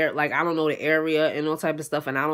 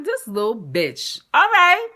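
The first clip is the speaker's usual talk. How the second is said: irritated; 8 semitones higher, 5 dB louder, slower than usual. Retold or imitated. Irritated reply to boyfriend.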